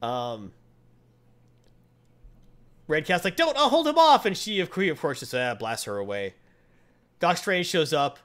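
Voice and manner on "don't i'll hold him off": high, louder urgent call; pitched up as she stands her ground; high brave shout; heroic cry. Retold or imitated. Red Cat stands her ground.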